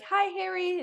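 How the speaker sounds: high-pitched mock fan hello; sing-out imitation of a greeting; imitated fan greeting. Imitating people greeting Harry.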